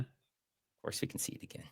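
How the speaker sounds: whispered "Of course we can see it again", no voice. Real, not acted. To the co-host asking for a replay, whispered. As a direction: breathe it in a hush. Teasing reply to replay request.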